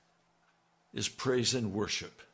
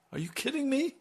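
much higher, disbelieving cry; incredulous; 13 semitones higher, faster than usual. Real, not acted. Preacher to congregation, incredulous high cry. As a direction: throw it out in disbelief.

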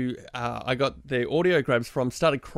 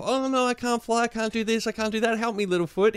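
put-on whiny baby voice; 6 semitones higher than usual. Mocking whiny Petrie.

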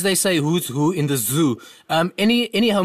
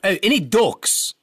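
slower and louder, spelled out; speaking louder; 3 semitones higher than usual. Real, not acted. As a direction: repeat it slowly for a deaf listener. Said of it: "I can't hear you".

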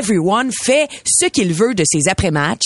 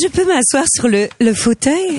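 princess voice; 3 semitones higher than usual. Acted.

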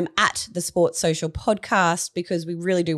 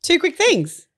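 excited; 13 semitones higher, 6 dB louder, slower than usual. Acted. Segment intro.

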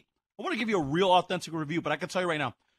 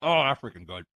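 ordinary delivery

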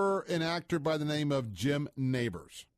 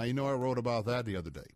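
ordinary delivery

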